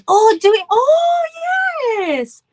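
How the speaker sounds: high, loud squeal of delighted surprise. Real, not acted. Finding out the fan shares her name, squealing with delight. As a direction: gasp with delight at the news.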